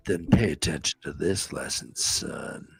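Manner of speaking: stern commander. Acted. The commander lecturing his captain, low and grave. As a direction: deliver it like a weary old general.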